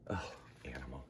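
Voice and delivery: very soft, breathy; whispered aside. Acted. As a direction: sigh it under your breath. Exasperated aside.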